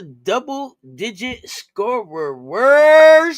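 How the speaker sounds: loud, high, long-held last word; big slow sing-out finish; announcer flourish. Acted. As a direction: draw it way out like an announcer. Echoing the co-host's announcer flourish.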